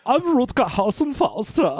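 put-on over-the-top German villain accent. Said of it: "the weirdest German accent".

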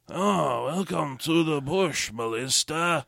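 hushed, slow mock French villain; bad French whisper. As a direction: murmur it slowly in a hammy French accent. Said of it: "a dude who whispers with a bad French accent".

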